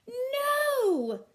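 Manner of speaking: put-on high loud child's no; yelled. Matthew yelling at his dad, high and loud. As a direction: shout it out like an eager kid. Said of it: "yelled Matthew".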